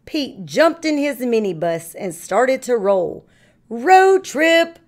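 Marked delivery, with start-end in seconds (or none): 3.7-4.8 high, loud, thrilled shout; excited cry. Acted. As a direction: cheer it like setting off on an adventure. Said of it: "cried Pete".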